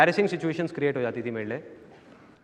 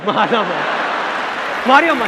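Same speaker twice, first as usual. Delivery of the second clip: loud, higher rising shouts to stop a fight; bystander shouting; 4 semitones higher, 4 dB louder, slower than usual. Retold or imitated. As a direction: yell at them across the road not to throw the brick.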